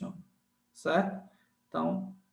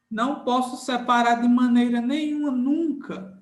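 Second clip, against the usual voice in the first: higher and insistent, landing on NUNCA; emphatic prohibition; 4 semitones higher, 4 dB louder than usual. Shown as a demonstration. Teacher forbids students to separate digraphs, emphatic prohibition. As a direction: forbid it firmly, raised pitch through "nenhuma, nunca".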